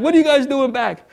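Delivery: high-pitched, caught-out surprise; retold startled question. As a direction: imitate yourself flustered by unexpected company. Caught out by returning friends.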